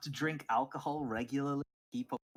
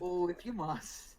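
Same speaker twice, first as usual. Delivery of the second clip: high, slow sighing Welsh barkeep; a little bit Welsh, resigned; Welsh accent; 7 semitones higher, slower than usual. Acted. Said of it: "A little bit Welsh there".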